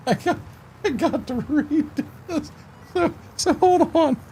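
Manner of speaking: high, squeaky voice fighting laughter; choked up with laughter, high-pitched; trying not to laugh. Real, not acted. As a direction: squeeze the words out through giggles. Cracking up before reading message.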